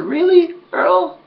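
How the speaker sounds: sarcastic comeback. Retold or imitated. Nance snapping back at Earl on the bus, loud sarcastic disbelief. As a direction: fire the sarcasm right back.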